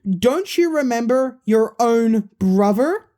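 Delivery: put-on high, loud kid voice for Balloon Boy; excited high character voice. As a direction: pitch it up as the cheeky balloon kid. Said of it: "Balloon Boy then says".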